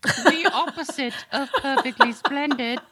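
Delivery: high British girl impression through laughter; girl saying "perfectly splendid". Capping the joke with Kat, imitated British girl's voice. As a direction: mimic a prim English child, amused. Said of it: "the girl that says "perfectly splendid".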